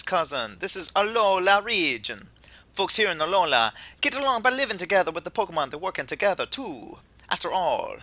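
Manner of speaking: put-on easygoing would-be Jamaican accent; attempted Jamaican accent. Kukui welcomes the player to Alola, attempted Jamaican accent. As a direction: do an amateur Jamaican voice, hammed-up. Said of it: "I know I can't do Jamaican".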